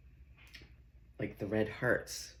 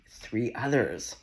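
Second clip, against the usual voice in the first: normal talking voice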